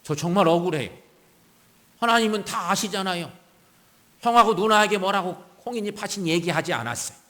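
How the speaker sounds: imagined protest of a wronged man in prayer; aggrieved pleading prayer. Acted. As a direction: plead your innocence, insistently. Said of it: "모세는 아마도 이렇게 기도했다고 봅니다".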